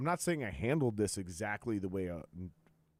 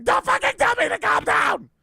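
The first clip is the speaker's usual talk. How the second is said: much higher, much louder enraged shriek; mock furious scream; 16 dB louder than usual. Mocking people who lost it at being told to calm down, shrieking. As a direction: scream it like someone losing it. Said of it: "And everyone's like".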